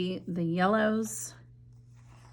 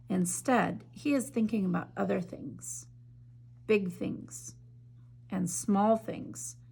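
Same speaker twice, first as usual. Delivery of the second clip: story narration. A narrator's reading. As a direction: read it like a bedtime story to children. Reads picture book aloud.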